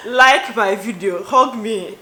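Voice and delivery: loud, slow, playful "Like my video."; playful loud plea to viewers; pleading loudly. Real, not acted. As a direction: beg them loudly, half joking. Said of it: "playful plea".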